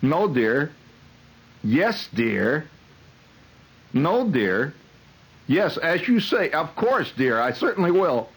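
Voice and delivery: dutiful yes-dear spouse, increasingly high and slow; henpecked marriage counselor. Acted. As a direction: meekly agree into the phone, pitch climbing. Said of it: "the marriage counselor kept say-, keeps saying".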